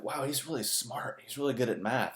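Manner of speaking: lightly mock naive classmates; a touch of gushing schoolkids; mock admiration. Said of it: "everyone was like".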